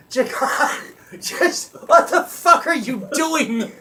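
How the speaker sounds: high, loud, laughing exasperation; exasperated yell. Retold or imitated. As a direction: yell it in disbelief. Panicked passenger yelling at driver.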